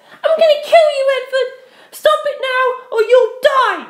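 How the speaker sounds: high, loud crazed threat; raging scream. Acted. Penelope screams at Edward, high and loud.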